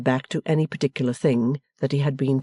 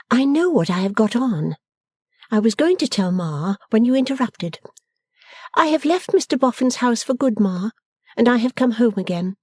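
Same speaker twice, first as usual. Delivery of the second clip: higher-pitched daughter voice; firm Bella; 9 semitones higher than usual. A narrator's reading. Bella telling her family she has come home, resolute. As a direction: state it firmly, pitched up. Bella's figure, answering firmly.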